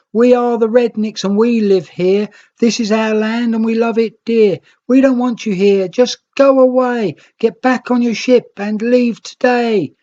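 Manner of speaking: high, loud hostile holler. Acted. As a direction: shout them off the beach. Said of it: "they hollered with one voice".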